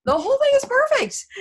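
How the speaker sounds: very high, loud, gleeful voice; excited mock lovestruck youth. Young Romeo gleefully shrugging off all problems, excited mock voice. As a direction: squeal it like a giddy kid with a plan. Acting out naive Romeo's carefree plan.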